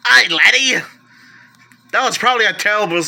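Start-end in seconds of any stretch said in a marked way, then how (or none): 0.1-0.8 mock Scottish accent, a bit louder; a hammy Scottish brogue for the Loch Ness joke; bad Scottish accent. Collector jokes about the Loch Ness Monster, bad Scottish accent. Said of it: "terrible Scottish accent".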